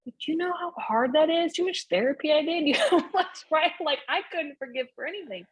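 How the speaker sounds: fed up with being told to forgive; exasperated. Real, not acted. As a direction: vent the frustration.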